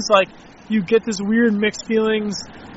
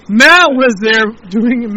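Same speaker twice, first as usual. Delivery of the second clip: ordinary talk, nothing marked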